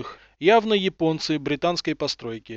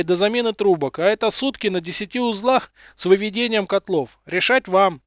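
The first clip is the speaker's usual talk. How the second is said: higher, louder voice of a cheeky engineer; chief engineer voice; 5 semitones higher, 4 dB louder than usual. Acted. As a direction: play the chief engineer, brisk and cocky. Голос главмеха через трубу. (главмех, бойкий голос)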